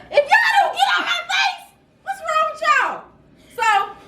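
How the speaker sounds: high, laughing mock yell at the dudes. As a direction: shout them off, half laughing. Retold near cuss-out of two dudes.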